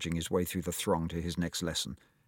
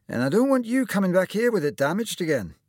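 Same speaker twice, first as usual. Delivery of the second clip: put-on higher, louder, hearty old surgeon voice; lightly voiced cheery doctor; kindly old surgeon; 11 semitones higher, 5 dB louder than usual. A narrator's reading. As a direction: play the jolly surgeon greeting his patient. Surgeon warns Tom.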